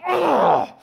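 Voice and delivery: loud high cry of frustration; frustrated groan. Retold or imitated. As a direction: let out an exasperated cry. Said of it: "So I'm like".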